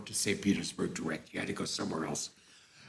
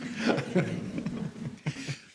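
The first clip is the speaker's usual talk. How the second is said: amused laugh at the memory; real laugh; 5 semitones higher than usual. Real, not acted. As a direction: let a real laugh slip out. Laughs after the joke.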